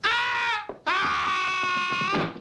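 two high shouting wails of panic; panicked cry. Acted. Father losing his mind over the money, crying out. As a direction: cry out in sudden shock and panic.

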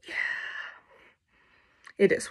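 under-the-breath whisper; a resigned little whisper. Real, not acted. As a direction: drop to a private whisper. Joking aside about his hair.

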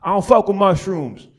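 emphatic refusal. Retold or imitated.

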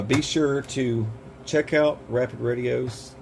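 ordinary delivery

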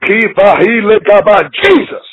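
usual speaking voice

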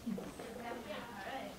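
normal talking voice

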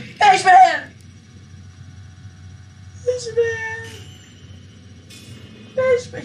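high, drawn-out wail of a name; anguished calling out. Pretend. Gamer calls after doomed Fishman, anguished cry. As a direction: cry out for a lost buddy.

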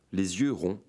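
plain reading voice

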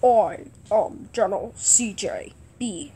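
low, quiet commander voice; General C J B. Pretend. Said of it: "This voice is like this".

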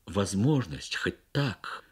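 ordinary delivery, nothing marked

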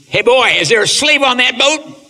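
loud, high call across the water; high, loud hail from a passing boat; hollering slave hunter. Retold or imitated. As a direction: shout it out like a gruff river man hailing a raft. Slave hunter calls to raft.

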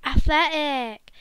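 usual speaking voice

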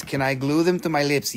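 ordinary talk, nothing marked

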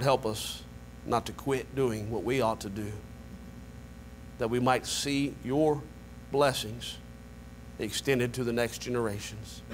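low, hushed prayer to God; soft pastoral prayer. Acted. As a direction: pray quietly and humbly over the church. Leads congregation in prayer.

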